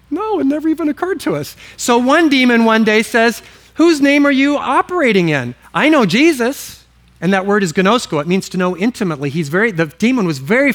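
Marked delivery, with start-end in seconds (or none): 0.1-1.6 high-pitched, quick demon reply; lightly mocked demon. Demon answering his buddies, high mock-innocent voice. 3.7-6.8 challenging demon. Acted.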